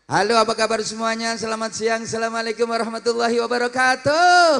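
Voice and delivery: high showy stage greeting; singer-style greeting. Shown as a demonstration. Preacher opening a sermon like a pop singer, showy and high. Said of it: "salamnya gaya penyanyi".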